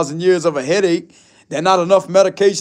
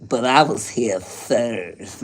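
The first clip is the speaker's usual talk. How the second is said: low put-on voice; 8 semitones lower, 4 dB quieter, much slower than usual. Arguing over who came first, low slow voice. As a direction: lower and slow, drawn out like a sulky character.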